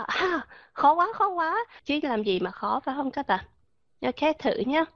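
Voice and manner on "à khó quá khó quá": high, whining mock "too hard"; teacher mocking students who say it's too hard; whiny complaint. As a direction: whine like a student giving up. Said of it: "mình cứ nói đại ra là".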